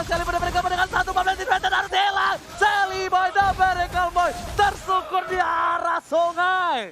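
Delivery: high, loud, slow call; excited shout. Real, not acted. Caster calls a team wipe.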